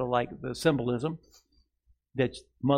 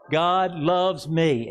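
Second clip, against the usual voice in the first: very slow, raised words to echo; very slow declaration; 5 semitones higher, 5 dB louder, much slower than usual. Shown as a demonstration. Pastor leading the congregation, very slowly. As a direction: stretch each word out slowly. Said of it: "Say this".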